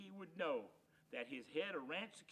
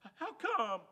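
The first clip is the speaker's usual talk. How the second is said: higher, louder startled stammer; stammering shock; 8 semitones higher, 9 dB louder than usual. Acted. Drover stunned that Hank refuses him, startled stammer. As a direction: blurt out in dismay.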